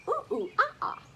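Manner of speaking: high, loud playful monkey calls; monkey sounds. Pretend. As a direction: hoot like a cheeky monkey for the kids. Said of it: "make monkey sounds".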